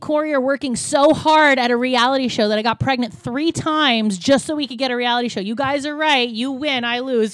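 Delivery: loud, mocking address to the haters; sarcastic rant. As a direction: throw it back at the critics, loud and sneering. Sarcastic rant at online critics.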